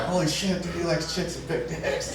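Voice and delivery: hushed, amazed aside; stunned private thought. Retold or imitated. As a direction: marvel under your breath. Private thought on seeing friend.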